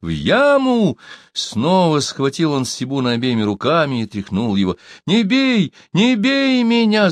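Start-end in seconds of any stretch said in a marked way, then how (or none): 0.0-1.0 high outraged repetition; storyteller's raised exclamation; exclaiming. A narrator's reading. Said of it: "воскликнул Песотька". 5.1-7.1 high, frightened cry; storyteller voicing a cowering captive; wailing plea. A narrator's reading. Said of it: "завопил Сибун".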